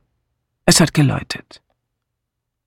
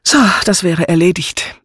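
mildly satisfied, a little higher; 5 semitones higher, 4 dB louder than usual. A narrator's reading. Hostess has finished arranging the room, satisfied. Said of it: "erklärte sie zufrieden".

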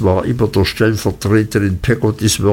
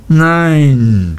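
a forceful cry, louder and higher; 4 semitones higher, 4 dB louder than usual. A narrator's reading. Nurse reassures the frightened boy, forceful cry. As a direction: blurt it out with surprising force. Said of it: "schrie Peggotty mit erstaunlicher Kraft".

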